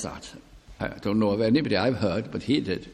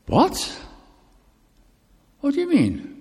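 much higher, retold shock; startled question. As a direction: jump up in pitch, caught off guard. Retold shocked reply to "saved?.

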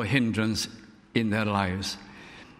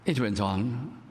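normal talking voice

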